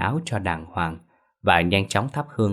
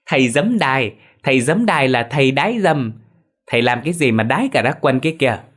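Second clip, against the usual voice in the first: put-on a higher, louder childish singsong taunt; teasing taunt; 6 semitones higher, 5 dB louder than usual. Son mocking his father for wetting himself, loud teasing chant. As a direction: tease your father like a cheeky boy. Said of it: "mà cười ngặt nghẽo".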